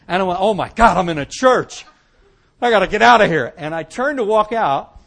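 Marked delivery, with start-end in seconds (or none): 0.4-3.5 louder, panicked realization; loud retold panic; alarmed outburst. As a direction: blurt it out in sudden alarm. Panic on realizing it's a church.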